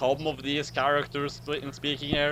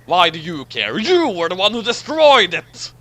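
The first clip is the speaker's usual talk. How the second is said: indignant shout; 9 dB louder than usual. Acted. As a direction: accuse him, voice climbing. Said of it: "Picard responded".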